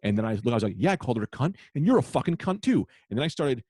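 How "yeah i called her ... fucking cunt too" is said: raised pitch, building louder; retold angry comeback. Comic turning on the crowd, heated retort. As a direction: re-enact a defiant outburst.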